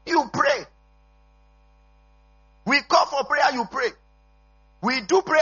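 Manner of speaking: high-pitched, insistent sermon delivery; forceful preaching. Real, not acted.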